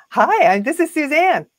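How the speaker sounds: high, loud phone-sales hello; smiling sales greeting. Shown as a demonstration. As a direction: greet the caller with a big cheesy smile. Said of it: "I got a cheesy smile on my face".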